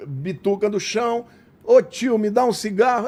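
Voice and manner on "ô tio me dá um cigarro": loud, high pleading with a laugh in it; begging for a cigarette. Retold or imitated. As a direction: beg a stranger for a smoke, half laughing. (pedindo cigarro num tom alto e choroso, meio rindo)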